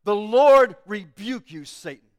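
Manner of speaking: slow, loud, commanding shout; shouting rebuke. Acted. Angel of the Lord rebuking Satan, slow loud shout. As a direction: bellow it out against the accuser, slowly. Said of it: "he shouts".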